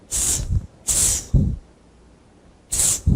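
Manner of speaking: Ts repeated as a voiceless t-s hiss; ts sound demo. Shown as a demonstration. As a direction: clip each ts short and sharp. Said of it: "É esse o som". (ts sem voz, repetido)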